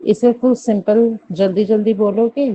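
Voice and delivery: ordinary talk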